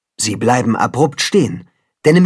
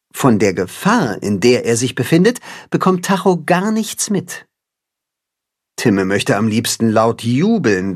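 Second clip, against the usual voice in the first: ordinary delivery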